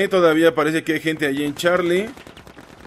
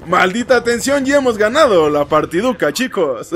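excited; 4 semitones higher than usual. Real, not acted. Celebrating a won match.

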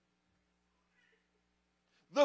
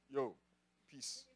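very soft, hushed sign-off; meek retreat; 12 semitones lower, 12 dB quieter than usual. Retold or imitated. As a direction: back away quietly from danger. Acts out backing off from lion.

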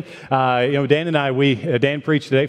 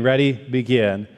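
ordinary talk

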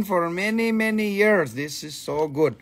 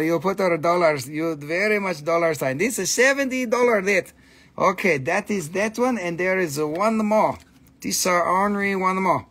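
ordinary talk, nothing marked

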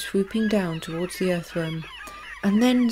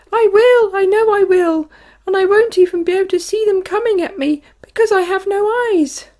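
very high, loud, fast frantic wail; panicked wail; 12 semitones higher, 9 dB louder than usual. A narrator's reading. Earthworm insists in panic that he'll be pecked, frantic. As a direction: rush it out in rising panic.

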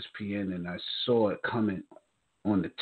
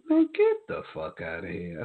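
squeaked-up retold disbelief. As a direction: re-enact stunned disbelief way up high. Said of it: "just said it just like that".

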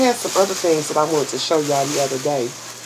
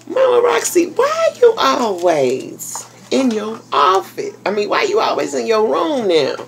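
imitating a fan's question; 8 semitones higher than usual. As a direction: pitch it way up and drag it out, quoting the asker. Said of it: "Somebody had asked me".

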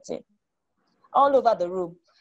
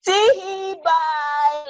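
teasing tee-hee; 9 semitones higher, much slower than usual. A narrator's reading. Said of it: "laughed her brother".

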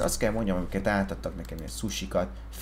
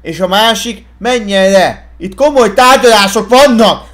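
furious shout at the table; angry boss screaming; yelling angrily; 13 semitones higher, 17 dB louder than usual. Acted. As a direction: blow up and roar at them. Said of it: "Ne ordibáljál".

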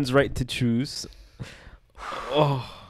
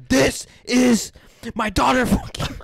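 high, loud mock war cry; hammed-up heroic bellow; Sparta-style yell; 11 semitones higher, 6 dB louder than usual. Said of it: "you have to, like, almost yell it".

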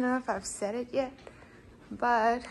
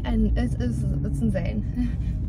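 ordinary talk

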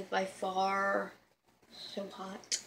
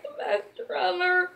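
ordinary talk, nothing marked

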